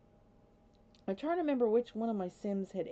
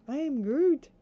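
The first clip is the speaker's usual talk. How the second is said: higher, louder playful catchphrase; Groot; 7 semitones higher, 8 dB louder than usual. Acted. Fan quoting a beloved movie character, playfully excited. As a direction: quote the character with gleeful gusto. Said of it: "I love that character".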